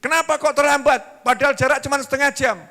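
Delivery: high, loud nagging; furious wife berating her husband for coming home late; angry scolding wife. Acted.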